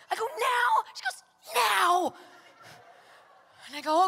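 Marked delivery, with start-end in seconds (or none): 0.3-0.8 high, loud, startled "Now?"; incredulous question on NOW. Retold or imitated. Disbelieving question back to friend. 1.5-2.1 loud, high, emphatic "Now"; insistent command on NOW. Retold or imitated. Friend insisting, retold.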